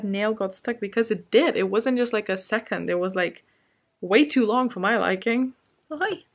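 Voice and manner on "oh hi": pet talk. Real, not acted. Greeting a pet.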